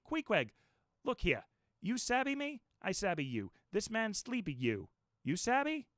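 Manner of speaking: high, coaxing pidgin to a foreigner; landlord in pidgin. A narrator's reading. Landlord coaxes Queequeg to let the guest sleep. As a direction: talk him down simply.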